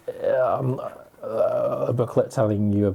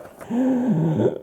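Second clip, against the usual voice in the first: excited gasp. Real, not acted. Opening the camera box.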